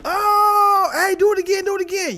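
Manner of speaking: high, loud, squealing hype-man voice; cheering land voice. Acted. The land cheering a lucky thrower, high and loud. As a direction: shriek it like a crowd egging you on.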